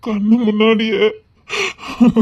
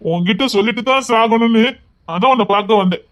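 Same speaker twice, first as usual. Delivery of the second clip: normal talking voice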